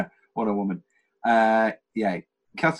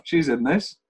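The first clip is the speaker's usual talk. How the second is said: ordinary talk, nothing marked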